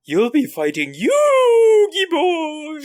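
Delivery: high, slow, wavering ghost voice. Acted. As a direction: wail it like a spooky ghost. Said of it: "Why did he sound like a ghost?".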